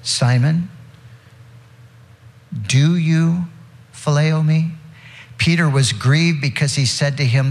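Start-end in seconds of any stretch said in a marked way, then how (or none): 0.0-4.7 a long pause before phileo, then phileo lifted and leaned on; stress on phileo. Shown as a demonstration. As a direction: hold back, then set phileo apart. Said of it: "Now, the third time".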